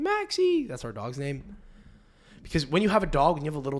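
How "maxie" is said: very high singsong call; mom calling dog. Retold or imitated. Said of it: "you hear my mom".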